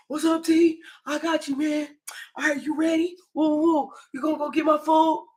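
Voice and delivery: high, cheery mimic of a friend; Jeezy voice.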